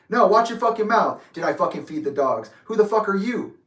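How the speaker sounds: imitating a father's angry telling-off; retold heated challenge; going off. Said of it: "Just starts going off on me".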